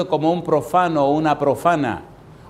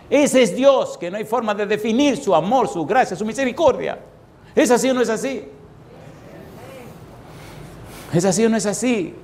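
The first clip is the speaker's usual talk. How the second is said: higher, urgent preacher's cry; fervent preaching; 9 semitones higher than usual. Acted. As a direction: lift the crowd with God's love. Preaching at full force. (predicador exaltado, voz alta y fervorosa)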